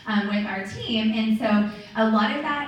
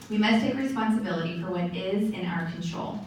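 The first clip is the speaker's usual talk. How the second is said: usual speaking voice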